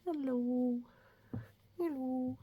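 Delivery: high little puppet greeting; lightly voiced stuffed bunny. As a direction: say hello as the toy rabbit. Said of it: "show you my friend Flopsy".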